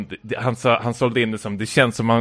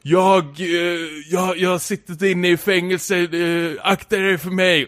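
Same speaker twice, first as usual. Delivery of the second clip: high-pitched, halting mock threat; raised voice, stumbling between phrases; retold shouting; 5 semitones higher than usual. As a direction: act out a worked-up man yelling a warning. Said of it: "skriker han något".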